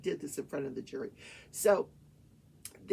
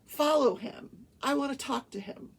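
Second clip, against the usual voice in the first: raised, higher voice; retold urgent order; 6 semitones higher, 5 dB louder than usual. Husband telling wife to follow the cyclist, urgent order. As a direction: give a firm retold command.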